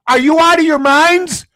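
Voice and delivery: high, loud, incredulous yell; angry outburst. Real, not acted. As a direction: blast them in disbelief. Ranting at the league.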